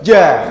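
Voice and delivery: JA released loudly after holding the air back; released jim. Shown as a demonstration. Teacher releasing the held letter, firm jim. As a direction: hold the tongue to the palate, then push out JA. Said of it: "Terus baru dikeluarkan".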